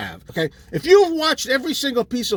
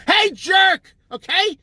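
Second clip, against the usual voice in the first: higher, sharp yell at the listener; annoyed shout; 8 semitones higher, much slower than usual. Real, not acted. Ranting host yells at conspiracy fans, annoyed shout. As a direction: bark it at the idiots.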